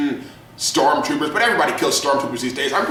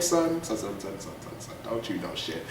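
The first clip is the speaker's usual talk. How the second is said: whispered son son son, much softer; softer and lower, whispered mockery; mock scolding whisper; 9 semitones lower, 13 dB quieter than usual. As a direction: hush them like a pitying elder. Scolding naive viewer.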